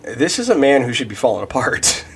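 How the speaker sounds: ordinary talk, nothing marked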